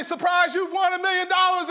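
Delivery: high, loud surprise shout; excited announcement. Retold or imitated. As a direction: yell the prize news with glee. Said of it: "and they say".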